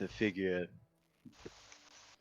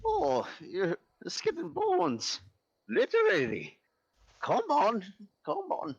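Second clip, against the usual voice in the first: guilt tripping grandma; 11 semitones higher than usual. Acted. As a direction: cluck over her like a fussy nan. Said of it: "sees Mary and goes".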